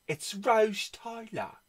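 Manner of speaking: "Rose" said as "Rauz" in a put-on British accent; mock British "Rose". Shown as a demonstration. Showing off the cover to viewers in a British accent. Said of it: "you know it's Rose Tyler".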